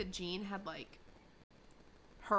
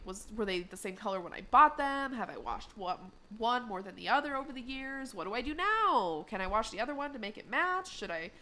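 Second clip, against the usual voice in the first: imitated fretting that climbs higher and higher; mock spiraling worry; 4 semitones higher, 4 dB louder than usual. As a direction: start questioning, build to a high, loud comic panic. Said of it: "he started kinda spiraling".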